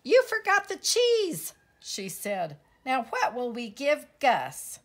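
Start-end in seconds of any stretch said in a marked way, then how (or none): 0.0-1.5 very high, loud accusation; scolding outburst. Acted. Squeak blaming Pip for forgetting cheese, scolding outburst. 2.9-4.8 loud, raised-pitch demand; exasperated scolding. Acted. Squeak fretting over the gift for Gus, exasperated scolding.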